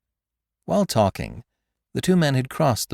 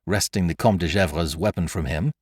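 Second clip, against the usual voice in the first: ordinary delivery, nothing marked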